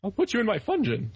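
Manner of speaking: high, prim mock-British voice; Mad Hatter impression. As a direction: ham up a fussy Mad Hatter. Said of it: "And the Mad Hatter's like".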